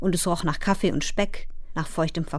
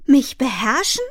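high, louder, rising question; indignant cry; 5 semitones higher, 5 dB louder than usual. A narrator's reading. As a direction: throw it back loudly in disbelief. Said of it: "schrie Fern". (empörter, lauter Aufschrei als Frage)

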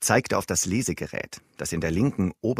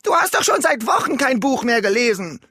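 höher und lauter, vorwurfsvoll; spöttischer Vorwurf durchs Haus; laut rufende Ehefrau; 7 dB louder than usual. Acted. (vorwurfsvoll rufend)

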